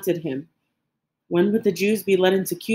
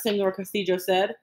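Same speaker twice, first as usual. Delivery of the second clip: plain reading voice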